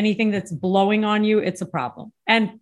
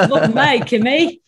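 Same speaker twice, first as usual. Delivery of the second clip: laughing, louder Australian imitation; imitated Aussie accent; 4 semitones higher, 5 dB louder than usual. Shown as a demonstration. Guest copies the host, laughing Aussie accent. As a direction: giggle through a broad Aussie catchphrase. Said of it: "in your best Aussie accent".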